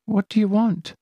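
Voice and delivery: a touch of fear; fearful. Acted. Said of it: "voice tinged with fear".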